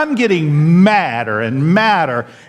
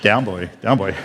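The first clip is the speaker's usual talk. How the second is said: soothing joke; 8 semitones lower, 4 dB quieter than usual. Retold or imitated. Calming angry pastor.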